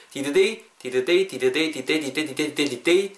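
"did they" repeated until the sounds blend; "did they" running together in quick repeats; linked "did they". Shown as a demonstration. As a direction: drill "did they" as one linked word. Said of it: "이렇게 음이 연결되거든요".

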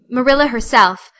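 ordinary delivery, nothing marked

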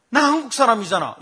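put-on high, loud child's protest; mock girlish outburst; imitated daughter. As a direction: object indignantly as the daughter. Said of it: "그랬더니 우리 딸입니다".

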